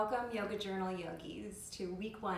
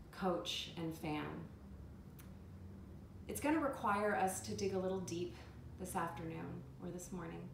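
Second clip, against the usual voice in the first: calm, measured meditation guide; guided meditation. Acted. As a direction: guide listeners inward with unhurried pauses.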